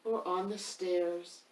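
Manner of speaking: lively picture-book reading; reading to children. A narrator's reading. Reader performs the rhyming book for children. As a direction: read it to the kids with bounce.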